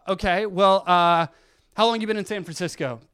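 a doctor quickly trying another question, eager; bright coaxing doctor. Retold or imitated. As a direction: rush a cheerful follow-up. Doctor tries again.

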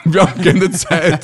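laughing mock quote. Joking repeat of old man.

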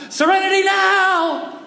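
high, drawn-out mock yell; impatient yell. Impatient father in the car yells out, high-pitched shout. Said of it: "he begins to yell out".

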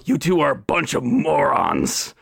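a hint of a cartoon cat; lightly voiced cartoon cat; Meowth. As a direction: snark it like a sidekick. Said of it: "you see the Tabaxi go".

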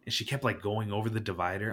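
ordinary talk

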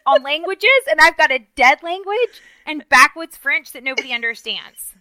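loud, high comic indignation; exasperated rant. Real, not acted. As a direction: complain hard, punching the key words. Comic rant about useless language studies.

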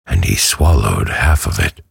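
usual speaking voice

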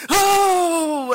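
high, very loud drawn-out oh; excited holler. Retold or imitated. Fan seeing another catch, excited holler. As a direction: burst out cheering, unable to help it. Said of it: "I wanna praise, but I can't".

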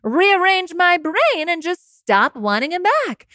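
sarcastic carefree voice for the breakup; mock breezy. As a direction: brush it off as if it were easy. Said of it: "be in a position to be like".

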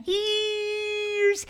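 EARS stretched long and high; drawn-out word. Acted. Unicorn recalling wind up high, drawn-out word.

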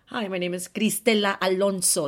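name with rolled r and Spanish vowels; Spanish accent. Retold or imitated. Host pointedly introducing herself to critics, Spanish accent. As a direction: say Cristela Alonso the Spanish way. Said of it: "I say that with an accent".

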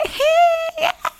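very high squealing hey; overexcited roommate. Retold or imitated. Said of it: "la roommate de Chelsea es así toda como que".

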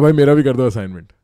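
loud, fast read of a chat line; reading chat aloud. Retold or imitated. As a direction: call out the comment. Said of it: "कोई कह रहा है". (ज़ोर से chat पढ़ते हुए)

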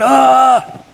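recalled anguished cry; retold anguished scream. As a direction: let out a pained yell, retelling it. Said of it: "I was just going".

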